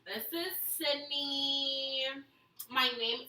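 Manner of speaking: put-on slow, drawn-out Valley Girl. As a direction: stretch the word endings like a Valley Girl. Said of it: "You're not gonna do your Valley Girl voice?".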